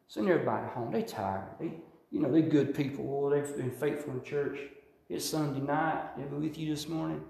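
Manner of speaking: soft, gentle, coaxing voice; soothing, caring whisper of a voice; compassionate voice. Retold or imitated. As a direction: coax him tenderly to send them home. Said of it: "a compassionate voice came to me".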